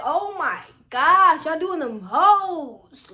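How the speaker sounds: slow, stretched-out groan of annoyance; exasperated complaint. Retold or imitated. Student annoyed at being suspected of cheating, slow exasperation. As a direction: drag the words out, thoroughly fed up. Fed up with suspicious proctor.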